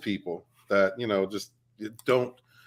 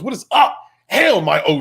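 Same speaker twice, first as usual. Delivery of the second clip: excited shout-out; 8 semitones higher, 9 dB louder than usual. Real, not acted. Greeting a chat member loudly.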